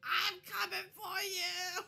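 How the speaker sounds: high, loud, slow goblin shout; goblin charging threat. Acted. Goblin charging at the party, shouting a threat. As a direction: yell it while charging with a shiv. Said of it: "he's like".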